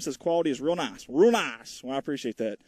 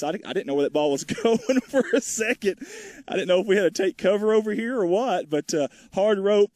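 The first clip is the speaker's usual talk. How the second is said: laughing, higher and louder, caught off guard; startled laughing; 6 semitones higher, 4 dB louder than usual. Real, not acted. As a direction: laugh it off after a near miss. Ball nearly hit the booth.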